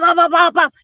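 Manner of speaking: usual speaking voice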